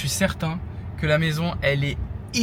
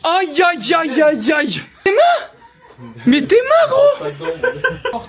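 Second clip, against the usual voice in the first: excited shouting; 13 semitones higher, 4 dB louder, much slower than usual. Real, not acted. Excited reaction, laughing.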